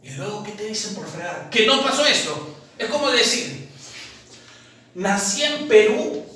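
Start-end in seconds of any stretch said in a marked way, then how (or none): none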